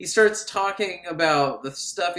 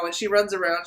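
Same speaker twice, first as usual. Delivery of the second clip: normal talking voice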